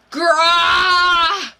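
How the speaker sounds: a loud, high, shouted beast roar; monstrous roar. Acted. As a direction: let it rip like a monster breaking out. Character transforms, roars.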